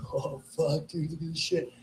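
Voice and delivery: low, soft retold amazement; hushed awe. Comic reliving finding something while tripping, hushed awe. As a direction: quietly marvel at a strange find.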